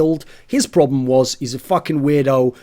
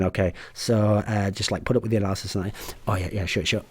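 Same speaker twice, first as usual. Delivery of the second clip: placating mumble; 5 semitones lower, 6 dB quieter than usual. Retold or imitated. Humoring the analyst.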